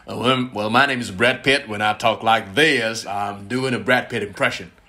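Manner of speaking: slow drawl with pushed-out words; Brad Pitt. Shown as a demonstration. Impressionist introducing himself as film star, pushed drawl. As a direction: push the words forward, unhurried. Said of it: "Brad Pitt tuh ada yang didorong".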